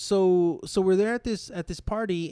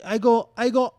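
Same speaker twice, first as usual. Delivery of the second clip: ordinary talk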